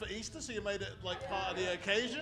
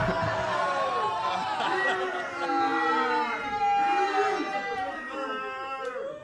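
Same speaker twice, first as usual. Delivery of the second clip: loud rising cackle, higher; host cracking up at the audience; big laugh; 9 semitones higher, 6 dB louder than usual. Real, not acted. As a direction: burst out laughing at the crowd reaction.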